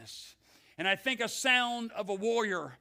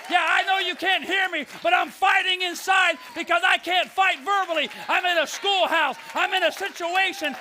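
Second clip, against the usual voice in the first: shouted prayer, higher and much louder; inner battle cry; 5 semitones higher, 6 dB louder than usual. Retold or imitated. Student fighting the enemy inside at school, shouting. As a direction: fight it out loud. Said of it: "I go inside me".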